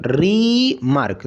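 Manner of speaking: रीमार्क with a long rising री, then मार्क dropped; drawn-out syllables. Shown as a demonstration. Teacher repeats the word to show two syllables, stretched. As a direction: stretch री right out, then land मार्क separately. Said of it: "यहाँ पे भी दो syllable".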